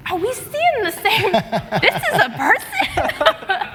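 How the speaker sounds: high-pitched disbelief, stretched at the end; incredulous. Retold or imitated. Player recalling looking at chat, incredulous. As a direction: squeak it out in mock disbelief. Retells disbelief at what she saw.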